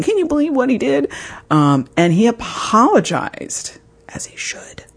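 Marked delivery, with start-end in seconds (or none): 0.0-1.1 indignant gossip. Retold or imitated. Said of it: "going to my friends going". 4.1-4.8 whispered aside. Real, not acted. Whispered aside about husband.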